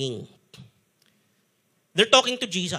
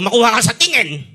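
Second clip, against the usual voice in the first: louder, stern warning; stern mother; 4 semitones higher, 9 dB louder than usual. Retold or imitated. Mother warning her child with a look, stern and louder. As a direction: warn the child like a strict mom. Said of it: "Yung nanay ko".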